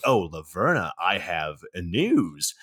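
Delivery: low, very slow hammed-up henchman; sneaky henchman. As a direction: drag it out like a scheming minion reporting in. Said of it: "he's like".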